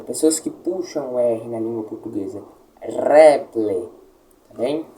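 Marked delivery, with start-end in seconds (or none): 2.8-3.9 "reple" opening on a long trilled r; rolled R. Shown as a demonstration. Teacher showing students the rolled r, "reple" with a long trill. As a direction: trill the r of "reple" like several r's. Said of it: "Como se fossem vários R's".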